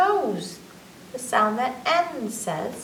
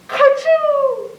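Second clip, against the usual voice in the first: mock sneeze; 9 dB louder than usual. Sneeze word in rhyme.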